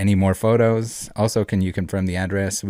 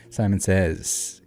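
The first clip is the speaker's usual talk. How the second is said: ordinary talk, nothing marked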